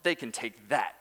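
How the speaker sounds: a hint of a sulky brother, rising then dropping; mock sulk. Preacher mocking older brother's refusal, lightly sulky. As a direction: scoff at the feast then refuse it. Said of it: "To say".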